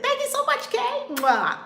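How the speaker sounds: high, loud gushing thanks; excited thanks. Real, not acted. Host breaking off to thank a viewer, high excited voice. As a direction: squeal the thank-you.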